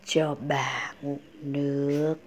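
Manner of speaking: a faint, parched grandmother asking for water; sick grandmother calling. A narrator's reading. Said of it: "Bà gọi".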